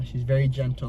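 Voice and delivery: ordinary talk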